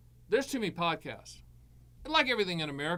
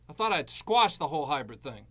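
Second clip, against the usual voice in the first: usual speaking voice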